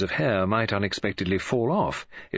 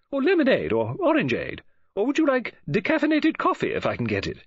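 bright, higher-pitched woman's voice; Mrs Oliver; 11 semitones higher than usual. A narrator's reading. Novelist greeting Poirot on the phone, bright high voice. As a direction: play the chatty lady novelist, lifted and eager.

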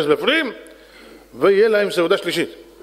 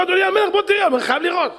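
high, urgent call to the king; excited calling; 14 semitones higher, faster than usual. Acted. Guards summoning the king to see, high urgent call. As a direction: call him over, breathless with news.

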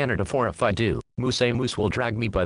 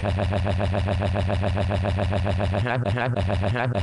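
low breathy laugh as a stroking sound; laughing noise; 3 semitones lower than usual. Acted. Jerking off in the story, low breathy laughing. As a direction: chuckle through it as the sound effect. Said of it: "stroking my bird dick like".

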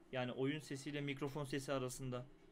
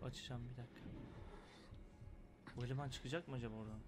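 whispering while hiding; 5 semitones lower, 9 dB quieter, faster than usual. Real, not acted. Hiding from monster, holding breath.

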